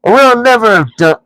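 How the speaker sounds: high, loud troll complaint; exasperated troll outburst; fed-up troll. A narrator's reading. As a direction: trolls sick of arguing. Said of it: "said they".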